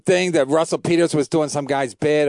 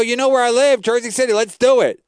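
higher, cocky challenge; retold taunt; 7 semitones higher, 3 dB louder than usual. Taunting the rude host with a higher, cocky dare. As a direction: dare him to come fight. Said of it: "I go, I go".

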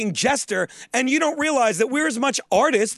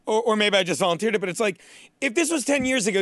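ordinary delivery

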